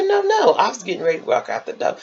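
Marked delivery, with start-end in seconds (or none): 0.0-0.5 lady's voice. Retold or imitated. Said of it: "She said".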